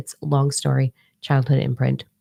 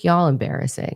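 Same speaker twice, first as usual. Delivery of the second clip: ordinary talk, nothing marked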